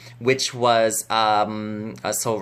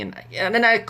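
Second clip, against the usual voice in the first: ordinary talk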